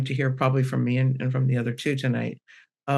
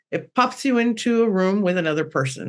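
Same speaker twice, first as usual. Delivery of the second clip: ordinary talk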